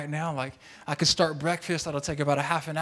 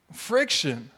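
ordinary delivery

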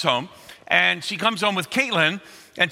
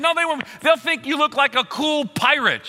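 high, bright-toned mom brushing it off; chirpy mom reassurance; 9 semitones higher than usual. Retold or imitated. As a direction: reassure the boy cheerfully. Mother reassuring child.

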